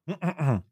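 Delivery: soft short cough; coughing fit. Real, not acted. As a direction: clear the throat with a cough. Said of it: "wir räuspern uns alle mal kurz".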